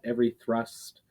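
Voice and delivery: usual speaking voice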